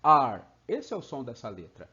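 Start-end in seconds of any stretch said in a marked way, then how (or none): 0.0-0.4 loud paulista R called out; São Paulo accent R. Shown as a demonstration. Teacher imitating a paulista to teach R, loud demo. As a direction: shout R like a paulista asking for it. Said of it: "um paulista pedindo R".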